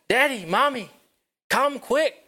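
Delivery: put-on high, loud excited little girl calling. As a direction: call them over, bursting with joy. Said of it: "So she got really excited".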